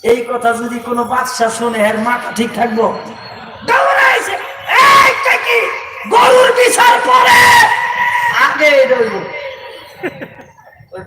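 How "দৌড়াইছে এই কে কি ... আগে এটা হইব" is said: high, loud sermon shout; a roaring waz preacher calling out over the crowd; fiery preaching. Acted. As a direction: preach it at full force to the crowd. Preacher shouting king's demand.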